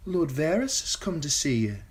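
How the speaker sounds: put-on high young woman's announcement; Shay announcing a guest. A narrator's reading. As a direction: present the visitor. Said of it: "Shay announced".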